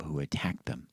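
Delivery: hushed, fading whisper. Acted. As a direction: drop to a whisper on the reveal. Villain introduced in story.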